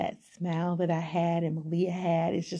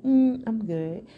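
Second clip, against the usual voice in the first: dismissive; 4 semitones higher, 3 dB louder, slower than usual. Retold or imitated. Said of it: "I'm like".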